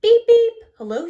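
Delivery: high, loud toy-like beep beep; chirpy machine beeps then hello; cheery beep greeting. A narrator's reading. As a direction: honk a bright hello like a little machine. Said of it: "she says".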